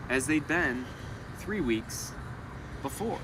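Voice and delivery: storyteller voice projecting to a classroom; story read-aloud. Acted. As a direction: read the picture book to the kids with energy. Teacher reads story aloud.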